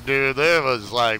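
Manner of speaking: usual speaking voice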